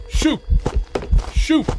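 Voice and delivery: high, loud yelp of shoot; shrill shout. Real, not acted. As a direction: cry out in alarm. Caught by the ghost.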